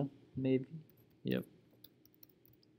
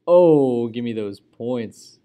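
excited; 11 dB louder, slower than usual. Real, not acted. Scoring points in game.

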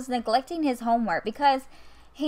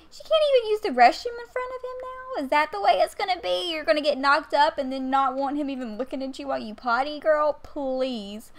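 high, fast indignant rant; 5 semitones higher than usual. Real, not acted. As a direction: sass her in disbelief. Mock indignation at a Sim.

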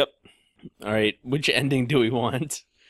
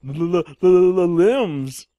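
spooked and shaky; 8 semitones higher, 10 dB louder than usual. Pretend. Mock scared stutter.

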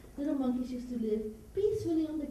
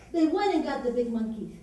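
plain reading voice

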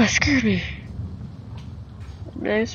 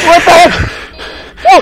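frightened shriek; 10 semitones higher, 13 dB louder, faster than usual. Real, not acted. Jumpscare in horror game.

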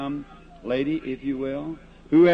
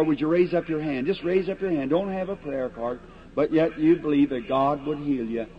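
ordinary talk

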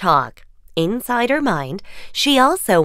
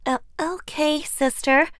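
timid okay; 7 semitones higher, 5 dB quieter than usual. Acted. Cinderella softly complying.

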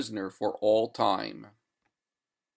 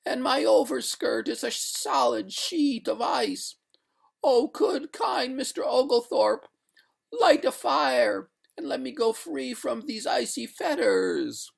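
high-pitched pleading wail; despairing ghost; 14 semitones higher than usual. A narrator's reading. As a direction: plead high and desperate for release. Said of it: "cried the ghost in despair".

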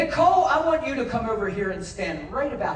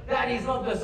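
usual speaking voice

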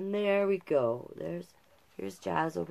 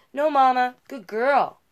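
put-on louder, higher child's protest; child insisting; 8 semitones higher, 13 dB louder than usual. A narrator's reading. Little Jazz corrects her mother, louder insistent child. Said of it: "I would say".

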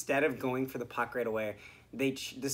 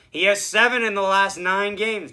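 mock broadcaster; 5 semitones higher, 10 dB louder than usual. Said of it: "They'll be like".

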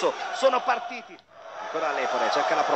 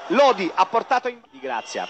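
ordinary delivery

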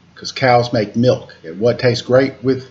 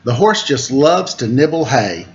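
put-on reading to children. A narrator's reading. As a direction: read the rhyme aloud to little listeners. Reads picture book to children.

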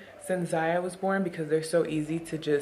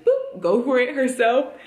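laughing; 6 semitones higher, 6 dB louder than usual. Real, not acted. Laughing about baby latching.

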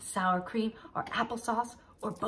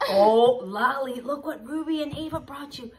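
ordinary talk, nothing marked

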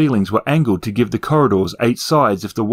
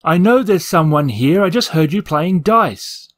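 calling out; 6 semitones higher than usual. Acted. Calling into tunnels.

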